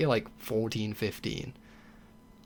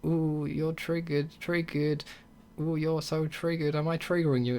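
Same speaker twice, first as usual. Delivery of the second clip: mocking taunt; 3 semitones higher than usual. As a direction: imitate a troll needling someone. Mimics trolls goading someone.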